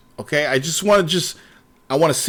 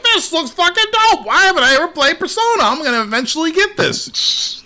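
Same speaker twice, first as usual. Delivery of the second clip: much higher, hyped past self; excited self-quote; 11 semitones higher than usual. Retold or imitated. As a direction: gush like a kid seeing the trailer. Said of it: "I'm like".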